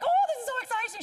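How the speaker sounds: high, gushing, laughing mum; excited mum impression. Young woman mimics her thrilled mother, high and gushing. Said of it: "Mary would have been like".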